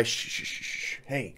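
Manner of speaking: soft unvoiced shushing; hushing the dog. Real, not acted. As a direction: quietly hush the dog to stop the barking.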